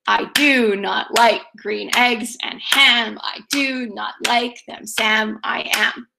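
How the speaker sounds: slow singsong "I DO not LIKE green EGGS and HAM"; iambic heartbeat rhythm. Shown as a demonstration. Teacher likens the rhythm to Doctor Seuss, slow iambic beat. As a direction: chant "I do not like", stressing every second syllable. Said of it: "Kinda sounds like Doctor Seuss".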